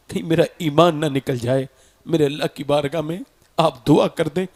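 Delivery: low, subdued voice of a repentant disciple; remorseful pleading. Retold or imitated. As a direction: plead humbly, as if confessing ruin. Distraught disciple begs for prayer.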